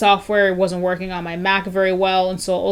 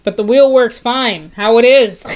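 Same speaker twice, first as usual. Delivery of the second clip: slow, louder and higher exasperated reply; exasperated retort; 4 semitones higher, 7 dB louder than usual. Retold or imitated. Answering trainers with slow, loud exasperation. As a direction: say it like it's obvious. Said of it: "we're just like".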